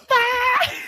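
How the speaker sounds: sudden high, loud mock wail; imitated child's wail. Son hurting his toe upstairs, imitated high wail. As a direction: cry out like a child who stubbed a toe.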